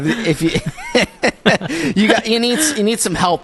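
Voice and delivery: high, giggling tease; laughing. Real, not acted. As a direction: laugh your way through the jab. Laughing through a jab.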